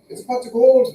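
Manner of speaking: high, loud mock Irish; Irish accent. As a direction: exclaim it like an Irish leprechaun, high-pitched. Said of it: "Is it the wee little Irish people?".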